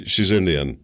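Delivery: usual speaking voice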